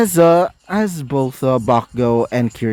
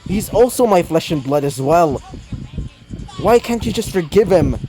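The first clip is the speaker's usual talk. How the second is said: higher, earnest plea for his father; pleading grandson; 5 semitones higher than usual. Acted. Izuku begging his grandfather to forgive his dad, higher pleading voice. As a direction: push up into a young voice begging him to forgive.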